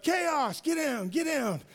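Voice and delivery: high, loud frantic yell; imitating his wife's frantic yell at the dog. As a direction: shout the dog down from the fence. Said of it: "starts yelling at him".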